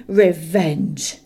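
"Revenge!" loud and falling, final; dragon's scorn. A narrator's reading. Smaug dismissing Bilbo's threat, loud falling scorn. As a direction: drop "Revenge!" like a verdict. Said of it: "he snorted".

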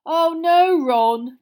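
loud, drawn-out mock reassurance; very sarcastic. Fred to Ron in cramped fireplace, very sarcastic. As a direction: lay the sarcasm on thick. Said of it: "came Fred's voice very sarcastically".

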